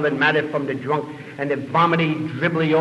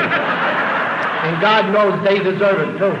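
laughing; 4 semitones higher, 4 dB louder, much slower than usual. Real, not acted. Laughing through punchline.

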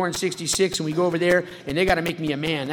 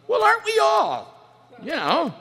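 mock indignant; 13 semitones higher, 4 dB louder, slower than usual. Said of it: "mock high exclamation".

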